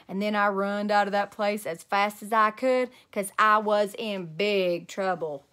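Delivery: ordinary delivery, nothing marked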